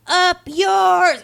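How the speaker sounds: high, loud gleeful Up yours; hammed-up triumphant jeer; mock jolly yell. Pilot taunting the aliens as he dies, mock jolly yell. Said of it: "he goes".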